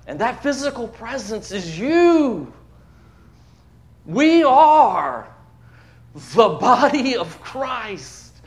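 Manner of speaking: fervent Southern preacher lifting the congregation; preaching at full force. Acted. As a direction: build it like a pulpit climax. Preaching the gospel story.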